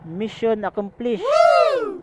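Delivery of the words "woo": a loud high whoop of triumph; cheer. Real, not acted. Vlogger celebrating getting through the dark road, loud whoop. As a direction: let out a big victory whoop. Cheering after mission accomplished.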